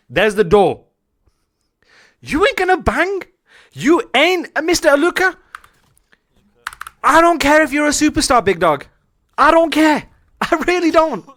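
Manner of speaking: high, loud coach tirade; acted angry rant. Coach throwing Aluka out of his office, loud mock fury. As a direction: bawl out the star player, pitch way up. Acted showing the player the door.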